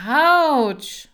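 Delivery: loud, high yelp of pain. Acted. Marcel yelping as Kira presses his knee. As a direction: cry out sharply.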